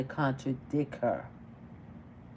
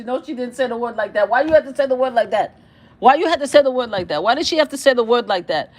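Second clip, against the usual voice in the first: high, loud and fast exasperated disbelief; 5 semitones higher, 7 dB louder, faster than usual. Real, not acted. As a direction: keep asking why, louder each time. Reacting to the word choice.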